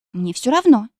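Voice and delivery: usual speaking voice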